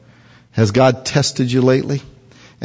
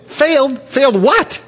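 much higher, whiny indignation; indignant soldier; 12 semitones higher than usual. Acted. Soldier Bob protesting to Gideon, high and whiny. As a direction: protest the unfair test.

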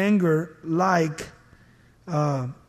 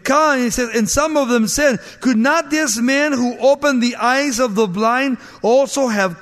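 raised preaching; 6 semitones higher, 4 dB louder than usual. Acted.

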